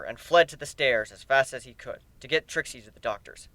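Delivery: ordinary delivery, nothing marked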